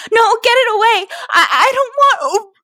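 loud, high-pitched desperate pleading; high, frantic protest cut off; panicked plea. A narrator's reading. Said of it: "My pleas for mercy were cut off".

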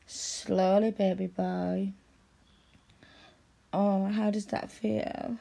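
usual speaking voice

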